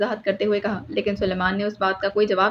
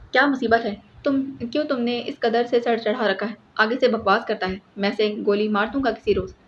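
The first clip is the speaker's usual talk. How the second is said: ordinary delivery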